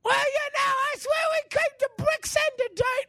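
silly squeaky mock British; hammed-up homeowner in a high squeak. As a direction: stretch it out in a put-on squeak. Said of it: "high squeaky voice convention".

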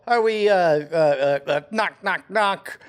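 ordinary talk

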